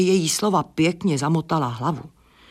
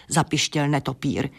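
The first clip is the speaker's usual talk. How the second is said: usual speaking voice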